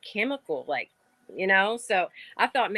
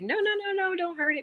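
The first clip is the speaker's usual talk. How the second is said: put-on much higher, pleading no-no-no to a kid; telling a child off; 13 semitones higher than usual. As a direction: gently stop a child hurting a bug. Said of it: "I'm always telling Aiden".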